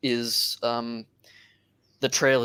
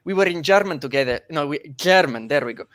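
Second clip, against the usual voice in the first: put-on halting Slavic accent with rolled R's; thick put-on Slavic accent, groping for words; Slavoj Žižek; 4 dB louder than usual. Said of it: "your Slavoj Zizek accent".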